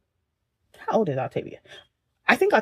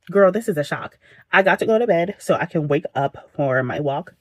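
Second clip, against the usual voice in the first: ordinary delivery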